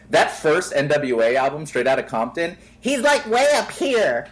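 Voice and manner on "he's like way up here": much higher, squeaky "way up here"; exaggerated high voice. Mocking young [name]'s high voice, much higher. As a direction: jump way up in pitch to mock him. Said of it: "I'm exaggerating".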